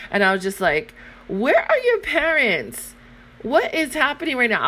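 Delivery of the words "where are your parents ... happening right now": shocked disbelief. Retold or imitated. Shocked mom asks about parents.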